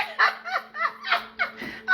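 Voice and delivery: high squealing laugh; delighted laugh. Real, not acted. Grandmother squealing with laughter, high.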